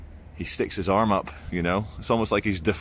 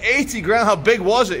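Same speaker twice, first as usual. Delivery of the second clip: much higher, louder disbelieving cry; astonished; 10 semitones higher, 5 dB louder than usual. Retold or imitated. Vlogger hears of the pricey screen, higher and astonished. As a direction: react as if the price just floored you. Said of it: "And I was like".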